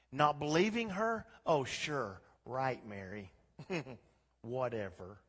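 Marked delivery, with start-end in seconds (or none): 1.5-5.2 dismissive scoff with a small laugh; mocking disbelief. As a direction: make fun of her, low and unconvinced. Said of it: "People were laughing and making fun".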